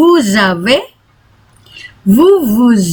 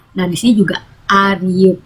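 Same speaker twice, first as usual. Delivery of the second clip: ordinary delivery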